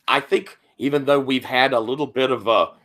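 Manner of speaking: ordinary talk, nothing marked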